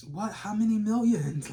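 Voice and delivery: high, loud astonished outburst; amazed, pitch and volume jumping up; incredulous question. Acted. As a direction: demand the figure in open disbelief. Astonished reaction to imagined riches.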